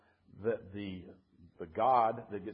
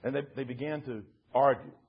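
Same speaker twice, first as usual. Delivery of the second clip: expressive bedtime story reading with measured pauses; storybook narrator; story narration; 2 semitones higher, 6 dB louder than usual. A narrator's reading. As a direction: read it to listeners as a dramatic fable, swelling and dropping. Reads a bedtime story aloud.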